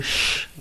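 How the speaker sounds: long hushing "sh"; demonstrated "sh" consonant on its own; sh sound. Shown as a demonstration. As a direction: hold the "sh" as a drawn-out hiss. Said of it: "Đọc chữ S H âm sh".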